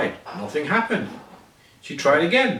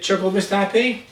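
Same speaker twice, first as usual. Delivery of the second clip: usual speaking voice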